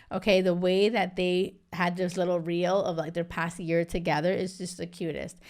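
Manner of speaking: ordinary talk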